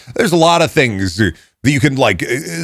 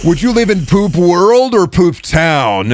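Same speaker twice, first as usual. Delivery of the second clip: mock podcast philosopher; 5 semitones higher, much slower than usual. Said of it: "You're just recreating Jogan".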